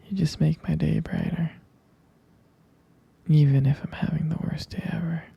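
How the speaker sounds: normal talking voice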